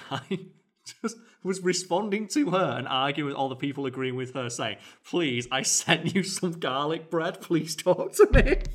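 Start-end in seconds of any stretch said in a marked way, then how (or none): 5.0-8.6 high, loud mock begging; drawn-out hammed-up plea, cracking into laughter; desperate pleading. Boyfriend begging his girlfriend in the comments, mock desperate.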